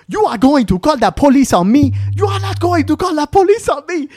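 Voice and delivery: high, loud, hammed-up African-accented outrage; shrieky mock fury in a put-on African accent; aggressive woman. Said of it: "the really aggressive one".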